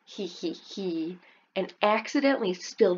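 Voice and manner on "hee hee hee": soft, slow sneaky snicker; dragon snicker. Acted. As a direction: snicker under your breath. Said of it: "Your dragon will snicker again".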